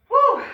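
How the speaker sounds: loud high woo; excited whoop. Real, not acted. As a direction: cheer out a triumphant woo. Cheering after finishing set.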